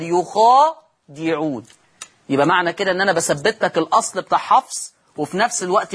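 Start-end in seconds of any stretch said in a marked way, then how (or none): 0.0-1.6 يخادعون very slow, lower, long a drawn out; Warsh recitation. Shown as a demonstration. Teacher recites the Warsh word for students, slow and lower. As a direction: chant يخادعون with the kha vowel held. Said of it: "والألف المادية بعدها وكسر الدال".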